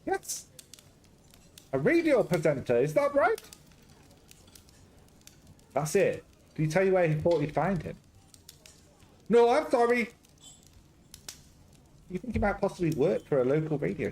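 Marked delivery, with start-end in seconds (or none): 0.0-3.4 put-on garbled Greek-Scottish accent. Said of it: "Garbled Greek Scottish". 9.3-10.1 high, loud hammed-up Greek-Scottish accent; a jolly Greek cook with a Scottish lilt, up high and loud; garbled Greek-Scottish accent. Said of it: "Garbled Greek Scottish".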